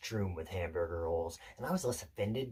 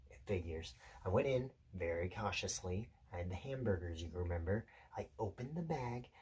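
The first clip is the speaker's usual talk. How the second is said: hushed suspense narration; 2 semitones lower, 5 dB quieter than usual. Acted. Telling Peter how the dog crept to the meat, hushed suspense. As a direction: creep through it quietly, pausing between moves.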